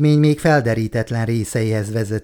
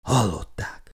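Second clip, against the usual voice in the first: a soft, resigned murmur; hushed, almost to himself; quietly; 3 semitones lower, 7 dB quieter than usual. A narrator's reading. Wieland quietly tells the team to turn back, hushed. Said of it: "mondta halkan".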